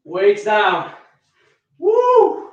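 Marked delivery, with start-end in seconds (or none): 1.8-2.5 very high "woo"; relieved whoop. Real, not acted. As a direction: whoop as the weights go down. Whoops as weights go down.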